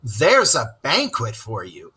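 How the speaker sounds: ordinary delivery, nothing marked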